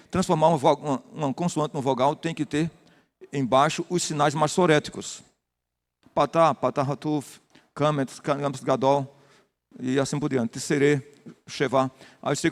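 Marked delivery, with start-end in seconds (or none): none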